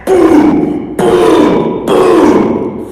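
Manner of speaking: heavy booms, each one separate; imitated stomping. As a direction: voice big footsteps as slow, punchy booms. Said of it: "I hear stomping".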